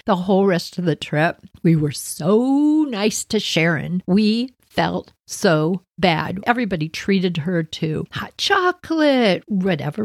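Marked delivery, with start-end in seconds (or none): none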